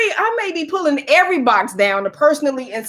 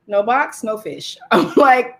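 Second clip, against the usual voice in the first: ordinary delivery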